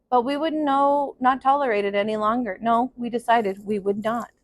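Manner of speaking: put-on steady storyteller reading to children; story narration. A narrator's reading. Teacher reading a picture book to her class, in a gentle storytelling voice. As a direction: read it out like circle time for kids.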